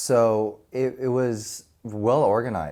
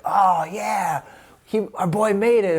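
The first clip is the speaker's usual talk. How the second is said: proud excitement; 7 semitones higher, 3 dB louder, slower than usual. Retold or imitated. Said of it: "we were like".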